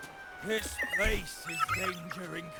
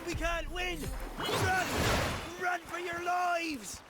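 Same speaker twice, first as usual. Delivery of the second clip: high, drawn-out shout to flee; desperate high call, last words stretched; panicked retreat cry; slower than usual. Acted. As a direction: yell for everyone to run. Routed fighters call retreat.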